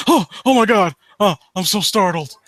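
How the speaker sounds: hammed-up mock startled gasps; mock fright, lightly overplayed; mocking Randy. Mocking found footage movies, hammed-up mock startle. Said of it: "Like Randy from fucking South Park".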